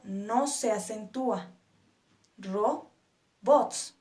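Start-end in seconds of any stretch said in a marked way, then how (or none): 2.4-4.0 "Robots" split in two syllables with a gap. Shown as a demonstration. Teacher showing learners the tonic syllable, "Robots" syllable by syllable. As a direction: break "Robots" apart as Ro, pause, bots. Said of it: "Nuestra sílaba tónica es bots".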